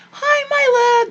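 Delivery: high, cooing "Hi, my love"; doting grandma greeting. Retold or imitated. Imitating grandma greeting the grandkids sweetly. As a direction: coo at the grandkids.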